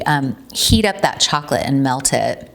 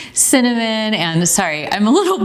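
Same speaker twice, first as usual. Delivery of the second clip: giggling; 5 semitones higher than usual. Real, not acted. Giggling after dancing mid-demo.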